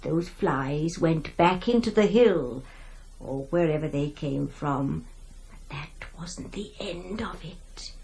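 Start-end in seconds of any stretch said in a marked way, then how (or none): 5.5-7.9 soft ominous whisper. A narrator's reading. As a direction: breathe it out as a warning. Narrator whispers ominous line.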